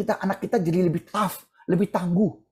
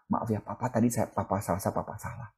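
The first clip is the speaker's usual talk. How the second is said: soft, half-whispered apology, much quieter; humble apology; 8 semitones lower, 8 dB quieter than usual. Acted. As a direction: say sorry almost under your breath, sincerely. Father apologizing to his child. (ayah meminta maaf kepada anaknya, pelan dan berbisik)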